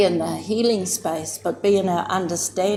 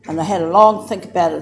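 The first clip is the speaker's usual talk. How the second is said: ordinary delivery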